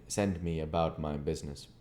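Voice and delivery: ordinary delivery, nothing marked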